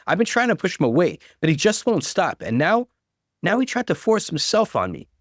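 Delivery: high, tearful rush of accusation; mild acted sobbing plea; crying. A narrator's reading. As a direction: play the wounded victim through tears. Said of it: "she cried".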